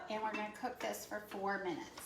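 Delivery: ordinary talk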